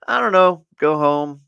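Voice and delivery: high, loud, oblivious answer; brightly miss the hint; clueless chirpy reply. Retold or imitated.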